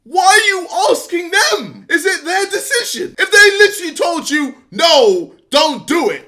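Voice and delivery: high, loud disbelief; incredulous shout. Real, not acted. [name] yelling at [name] over asking the crowd. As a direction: yell it in mocking disbelief.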